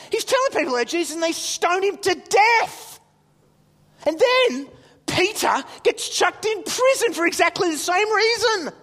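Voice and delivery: high, loud, emphatic storytelling; excited child. Retold or imitated. Son telling dad about Stephen and Peter, high and excited. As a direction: relay it like a kid amazed by it.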